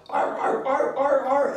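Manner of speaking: high-pitched seal barks; barking seal. Acted. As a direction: bark like an excited seal, loud and high. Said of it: "he can only communicate in arcs".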